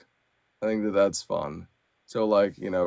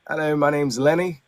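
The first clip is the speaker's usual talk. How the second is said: higher, louder cartoonish character hello; character voice for Lenny; 6 semitones higher, 5 dB louder than usual. Shown as a demonstration. Narrator jumping to the next character, higher perky voice. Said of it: "you've got all these different characters".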